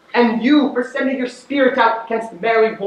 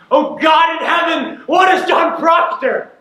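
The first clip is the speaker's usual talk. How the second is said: despairing shout, high and loud, slowing on the question; tormented man crying to God; anguished cry; 8 semitones higher, 3 dB louder than usual. Acted. Proctor's anguished outcry about his soul.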